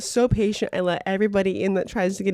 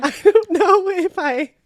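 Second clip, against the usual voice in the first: laughing helplessly; 10 semitones higher, 5 dB louder, slower than usual. Real, not acted. Breaking up while telling.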